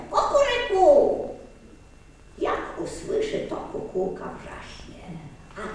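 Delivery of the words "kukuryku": slow, loud cock-a-doodle-doo; a rooster's angry crow. Acted. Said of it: "kogut na patyku zapiał gniewnie".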